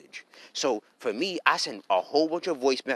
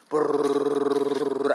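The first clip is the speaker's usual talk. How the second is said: long rolled gunfire trill; imitated gunfire. Imitating her firing off emotional outbursts, gunfire trill. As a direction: rattle off a machine-gun brrrr. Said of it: "she gunfires the ninth house".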